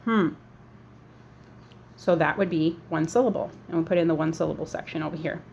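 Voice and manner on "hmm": one hummed beat; closed-mouth hum. Shown as a demonstration. Teacher repeats the one sound of heart, closed-mouth hum. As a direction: say it through sealed lips as a single hum. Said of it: "close your mouth and say heart".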